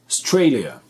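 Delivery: Australia punched on STRAY, first vowel nearly gone; second-syllable stress. Shown as a demonstration. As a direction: hit STRAY in Australia, then fall away. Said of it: "l'importance de l'accentuation".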